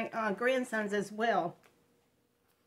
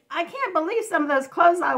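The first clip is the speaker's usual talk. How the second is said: laughing disbelief; 5 semitones higher, 9 dB louder than usual. Retold or imitated. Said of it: "I said".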